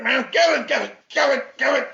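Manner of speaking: ordinary talk, nothing marked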